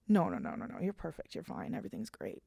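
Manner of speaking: almost whispered comfort to herself; self-soothing. Real, not acted. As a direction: talk yourself down quietly. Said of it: "self-reassurance".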